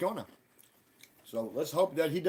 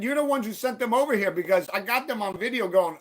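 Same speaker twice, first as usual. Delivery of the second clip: loud, high, heated confrontation; reenacted angry accusing; retold accusation; 8 semitones higher, 6 dB louder than usual. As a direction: call him out forcefully. Retells accusing him in quarrel.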